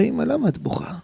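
high-pitched, pleading question; pleading son. Retold or imitated. Son asking his crying mother what is wrong, high and pleading. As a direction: lift the voice high as a worried child.

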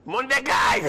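high yelled "מונדקאי" with falling end; "מונדקאי" shouted across the field, dropping at the end; coach yelling name. Retold or imitated. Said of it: "צועק לו המאמן".

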